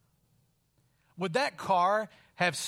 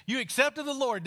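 higher and louder, eager; excited guess; 8 semitones higher, 4 dB louder than usual. Retold or imitated. Teacher greets former student, excitedly guessing his news. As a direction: pounce on the good news.

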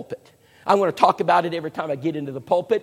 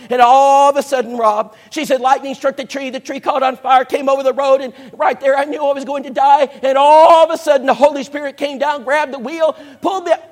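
climbing higher and louder as the story peaks; building excitement; 6 semitones higher, 7 dB louder than usual. Retold or imitated. Sheila tells the preacher about the storm, rising to a loud excited pitch. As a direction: swept up in the drama, getting louder.

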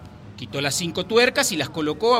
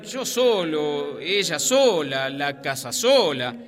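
very slow, drawn-out insinuation; suggestive excuse; much slower than usual. Acted. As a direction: stretch each sola knowingly. Confessing sinner excuses himself. (excusa lenta y pícara)

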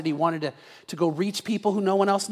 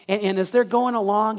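usual speaking voice

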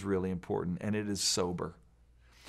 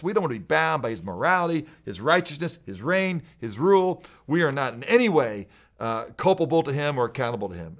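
higher, louder voice of rebels; mild defiance; 4 semitones higher, 5 dB louder than usual. Acted. Rebellious nations rejecting God's rule, mildly defiant. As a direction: list each refusal with rising force.

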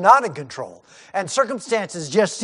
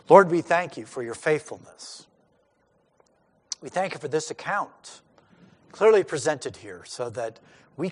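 closing prayer; 5 semitones lower, 4 dB quieter than usual. Acted. Pastor leads closing prayer.

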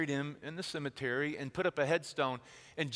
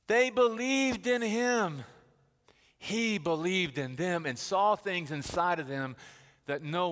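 full-voiced, rising sermon delivery; preaching; 4 semitones higher, 6 dB louder than usual. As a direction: preach it with conviction.

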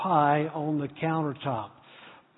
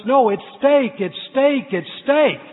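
higher, louder, calling across the beach; God calling after people running away, raised and insistent; 6 semitones higher, 6 dB louder than usual. Pretend. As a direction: call it out after fleeing people. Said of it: "God is calling out to us".

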